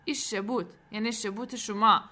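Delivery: mildly mocking echo through pouted lips; pouting jibe. A narrator's reading. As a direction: throw the word back with a pout. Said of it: "لبهایش را لوچه کرد و گفت".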